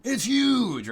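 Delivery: high, playful mock announcement; game pop-up exclamation. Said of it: "the little pop-up that comes over".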